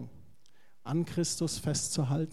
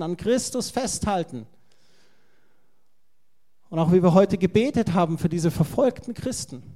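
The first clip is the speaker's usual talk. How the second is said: fervent preaching; 7 dB louder than usual. Real, not acted. Preacher building to climax.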